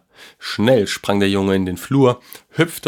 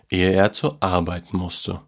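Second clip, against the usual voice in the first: usual speaking voice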